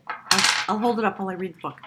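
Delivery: usual speaking voice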